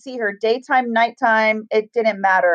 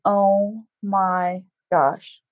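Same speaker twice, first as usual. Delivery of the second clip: drawn-out gasp; 3 semitones lower, much slower than usual. Retold or imitated. Retold shock as daughter confirms ghost.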